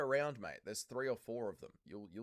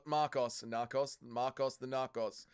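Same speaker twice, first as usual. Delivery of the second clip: ordinary talk, nothing marked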